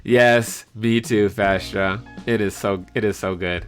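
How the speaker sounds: usual speaking voice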